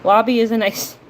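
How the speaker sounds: usual speaking voice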